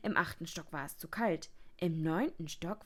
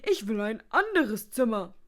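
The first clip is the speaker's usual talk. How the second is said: loud, cross demand, pitched up; annoyed; 5 semitones higher, 11 dB louder than usual. A narrator's reading. Guest demanding a move from reception, annoyed. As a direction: demand it with irritation. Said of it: "sagte Herr Schnarch verärgert".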